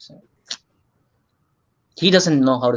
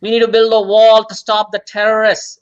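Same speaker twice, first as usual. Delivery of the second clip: raised, higher alarmist shouting; yelling talk radio host; 7 semitones higher, 4 dB louder than usual. Retold or imitated. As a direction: yell it like a conspiracy radio host. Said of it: "They don't keep yelling, you know, like".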